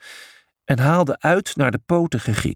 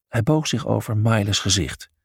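usual speaking voice

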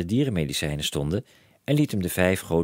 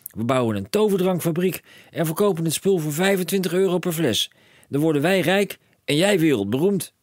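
high and loud, racing with plans; a dad who sees a fortune; excited cry; 12 semitones higher, 5 dB louder than usual. A narrator's reading. Father telling his son of riches, high excited call. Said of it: "riep meneer Krakeel".

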